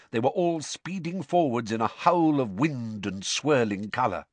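ordinary delivery

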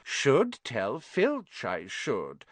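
slow, mock-pious poltergeist; saintly. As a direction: play the innocent do-gooder, slowly. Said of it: "said Peeves in a saintly voice".

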